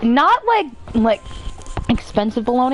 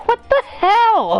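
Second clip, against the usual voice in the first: bewildered; 12 semitones higher, 3 dB louder, slower than usual. Retold or imitated. Hit with bologna while asleep.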